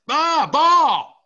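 high, loud yell at the screen; audible yell. Retold or imitated. Host re-enacting his disbelief at the botched snap, yelled. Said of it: "I audibly yelled".

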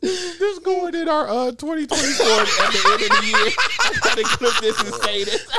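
high laughing talk. Real, not acted. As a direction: speak through laughter. Laughing about the argument.

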